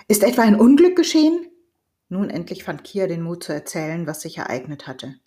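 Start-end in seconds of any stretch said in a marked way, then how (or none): 0.1-1.5 loud, high-pitched urgent question; alarmed cry. A narrator's reading. Father jumping up to ask about his son, crying out. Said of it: "Schon sprang Orsok auf und rief".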